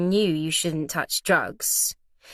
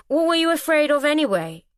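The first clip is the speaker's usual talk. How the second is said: persisting; 8 semitones higher, 5 dB louder than usual. A narrator's reading. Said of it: "Finn persisted".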